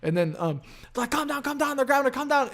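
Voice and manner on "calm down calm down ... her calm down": high-pitched, urgent pleading; imitated frantic calming. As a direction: act out people trying to restrain someone, high. Acting out people restraining her.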